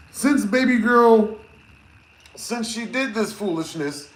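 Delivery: usual speaking voice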